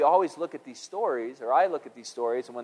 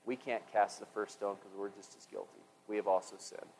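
much softer, lower; ashamed admission; 7 semitones lower, 14 dB quieter than usual. Retold or imitated. Pharisees admitting guilt, soft and low. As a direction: concede it under your breath. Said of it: "the oldest to the youngest go".

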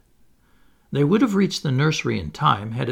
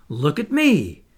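excited call; 2 semitones higher, 4 dB louder, slower than usual. A narrator's reading. Wendy calling while flying.

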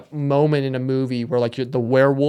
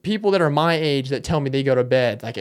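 ordinary talk

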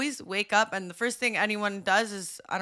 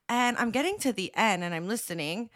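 ordinary delivery

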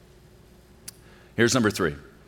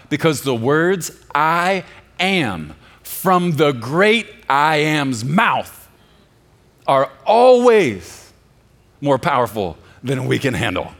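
loud, high, slow preacher's proclamation; preaching at full force; 5 semitones higher, 7 dB louder than usual. Acted. Preacher retelling the arrest of Jesus, loud and soaring. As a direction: thunder it from the pulpit.

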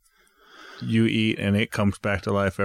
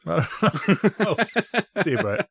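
laughing; 8 semitones higher, slower than usual. Real, not acted. Laughing at the voicemail bit.

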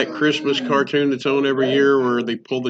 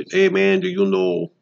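a badly faked Southern swamp accent, a little higher; horrible swamp accent; 6 semitones higher than usual. Imitating swamp folk in a mock Southern accent. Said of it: "That's a horrible accent".

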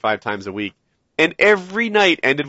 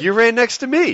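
raised but less shouted comeback; retold retort; 8 semitones higher than usual. Retelling his answer to a blaming friend, indignant retort. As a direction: throw the blame back, pitched up.

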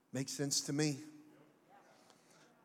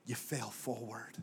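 whispering; 4 semitones lower, 5 dB quieter, slower than usual. Real, not acted. Soft close.

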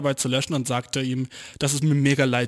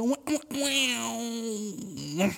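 mock whining; 7 semitones higher, 4 dB quieter than usual. Real, not acted. Overtired whining after an all-nighter.

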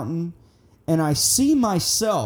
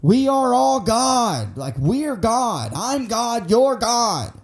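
high, drawn-out proclamation; imagined scream; 6 semitones higher, slower than usual. Retold or imitated. Wanting a microphone to tell the people, mock screamed proclamation. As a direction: proclaim it slowly to the world, pitched up. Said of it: "just scream it to the".

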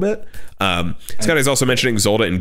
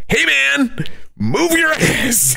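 higher, very slow stretched mock order; pushy hero; 14 semitones higher, much slower than usual. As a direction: drag it out, hurrying them along. Heroes shooing couple in retelling.

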